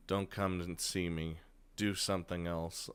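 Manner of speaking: Rin, quietly setting a limit. Acted. Voicing Rin.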